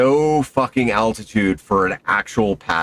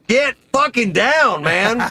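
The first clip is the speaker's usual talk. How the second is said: mock yell; 10 semitones higher than usual. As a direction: yell it like smacking his helmet. Smacking partner, yelling in freefall.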